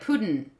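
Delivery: "Putin" said POO-dn, the i swallowed; "Putin" with oo, ending straight on a syllabic n; dropped I. Shown as a demonstration. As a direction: say "Putin" as poo-tn with no second vowel. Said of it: "The I is dropped".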